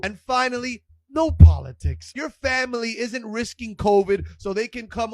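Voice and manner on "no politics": normal talking voice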